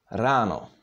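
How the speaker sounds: "Rano" with a fully pronounced, rolled R; rolled Czech R. Shown as a demonstration. Czech teacher reads the exercise word, "Rano" with rolled R. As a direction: say "Rano" with R like a Scottish R. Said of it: "R in Czech is fully pronounced".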